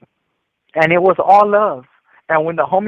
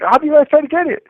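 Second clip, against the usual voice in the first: high, quick, louder amused question; imitated amused disbelief; 11 semitones higher, 5 dB louder, faster than usual. Older homie questions the failed watch grab, amused imitation. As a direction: act out a homie laughing as he asks. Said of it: "He's laughing".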